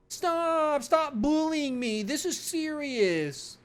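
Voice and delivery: high-pitched whining plea, slow; whiny pleading. Acted. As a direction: whine at them to stop like a hurt kid. Officer whining to mocking colleagues.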